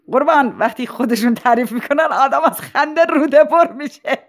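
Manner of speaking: high, loud, animated reply; eager flattery. Acted. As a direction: gush eagerly to a superior. Principal runs up to flatter mayor. (چاپلوسی پرهیجان با صدای بلند)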